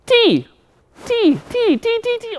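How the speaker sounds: high, hasty "T" where "see" was asked. Pretend. Puppet rushing his first word, high "T" for "see". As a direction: fire off "T" as "tee" in puppet squeal. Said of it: "Slow down".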